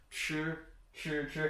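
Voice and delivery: "ch" with curled tongue, air squeezed through; fricative ch. Shown as a demonstration. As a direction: demonstrate "ch", tongue tip up, then friction. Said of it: "we would do a fricative sound".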